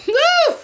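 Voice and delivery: high-pitched woo, pulling herself together; high whoop shaking off emotion. Real, not acted. Vlogger shaking off tears about her mom with a high woo. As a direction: let out a high woo to reset. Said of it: "I get very emotional".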